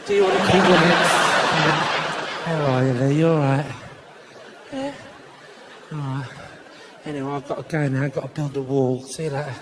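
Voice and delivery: low, menacing Cockney small talk; Cockney pleasantries with veiled menace; low East End hard man signing off; threatening Cockney friendliness. Acted. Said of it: "the more threatening it sounds".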